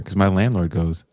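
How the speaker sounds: usual speaking voice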